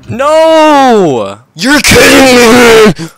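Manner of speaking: very loud, high, slow scream; high loud drawn-out outraged scream; furious scream. Real, not acted. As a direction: scream in disbelief. Killed by another player.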